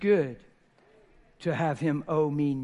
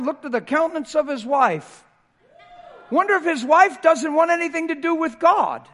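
loud, high, fervent preaching; 10 semitones higher, 10 dB louder than usual. Real, not acted. Pastor challenges the congregation, loud and high. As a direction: shout it out to the church.

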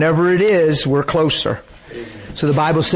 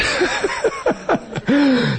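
high laugh; 14 semitones higher than usual. Real, not acted. Preacher laughs at own remark.

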